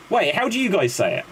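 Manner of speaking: usual speaking voice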